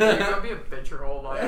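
fast, loud dark taunting voice; goth guy voice. Acted. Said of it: "that goth guy voice that you did just now".

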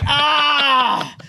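long, high, loud "ah" of surprise; acted starstruck scream. Customer seeing the owner on FaceTime, acted scream of surprise. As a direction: let out a drawn-out high yell. Acts out reaction to owner's call.

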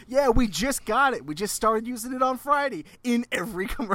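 loud, high mimicry of a commercial's news; mock ad enthusiasm. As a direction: imitate their excited announcement, breaking into laughter. Mimicking their recorded commercial, then laughing.

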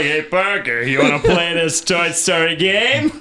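goofy, drawn-out character voice; Kronk. Retold or imitated. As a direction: put on a big dopey voice, half laughing. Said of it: "warped into, uh, oh, what's that guy? Kronk?".

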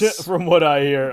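teasing. Pretend. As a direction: repeat it back knowingly. Teasing echo of friend.

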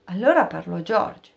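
plain reading voice

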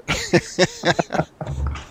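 high, amused laugh. Real, not acted. Host joking with guest about an accent takeover, laughing. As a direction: let out a long, high laugh.

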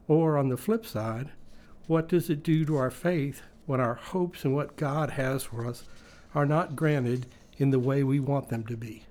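usual speaking voice

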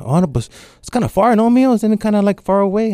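high, fretful father's voice; worried dad. Retold or imitated. Dad on the phone hearing about New York, high and fretful. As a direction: fret like an anxious old father.

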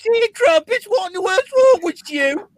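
hammed-up indignant Brit; British accent. Mocking the British voice back.

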